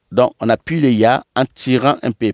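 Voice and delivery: ordinary delivery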